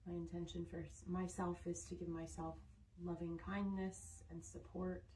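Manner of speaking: unhurried, soothing centring voice; guided meditation. Real, not acted. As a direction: settle them gently, with long restful pauses. Leads a calming centring before stretching.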